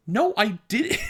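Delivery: high-pitched indignant protest breaking into laughter; upset denial. Retold or imitated. Accused kid denying telling on friends, comically indignant. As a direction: protest your innocence, half-laughing. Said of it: "I still get upset about that".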